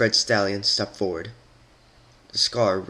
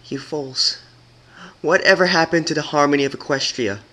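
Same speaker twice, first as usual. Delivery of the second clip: indignant rebuke; 6 semitones higher, 5 dB louder than usual. Acted. Twilight confronting the rebels.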